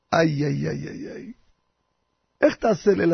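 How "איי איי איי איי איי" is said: low, soft groan of ay-ay-ay; dismayed ay-ay-ay. Acted. Grandfather asked to lead unprepared, low soft groaning. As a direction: groan it quietly to yourself, trailing away.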